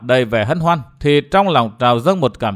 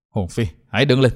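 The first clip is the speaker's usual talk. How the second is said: lower, subdued with emotion; choked up; 4 semitones lower, 3 dB quieter than usual. A narrator's reading. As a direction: speak through a lump in the throat. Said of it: "cất giọng nghèn nghèn nói". (giọng nghèn nghẹn, trầm xuống)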